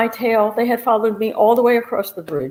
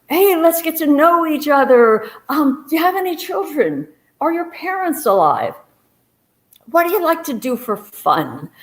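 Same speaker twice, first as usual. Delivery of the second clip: nervous small talk; 8 semitones higher than usual. Retold or imitated. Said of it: "I'm saying anything to her".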